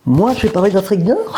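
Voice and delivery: high, laughing disbelief; mock surprise. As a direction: play innocent with a laugh. Feint d'oublier, en riant. (fausse surprise en riant, voix haute)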